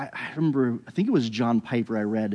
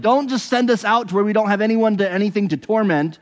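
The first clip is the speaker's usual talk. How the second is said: higher, louder begging demon voice; pleading demons; 8 semitones higher, 4 dB louder than usual. Retold or imitated.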